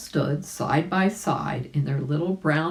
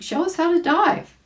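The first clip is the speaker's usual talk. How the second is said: put-on high eager call to the old frog; 4 dB louder than usual. Billy Mink urging Grandfather Frog to dive, eager high call. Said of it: "cried Billy Mink".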